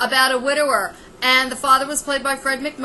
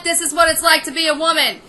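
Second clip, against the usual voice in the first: fed-up scolding; 2 semitones higher, faster than usual. Real, not acted. Ranting at Kim directly.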